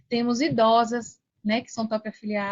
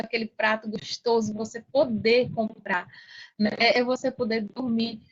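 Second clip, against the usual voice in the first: ordinary talk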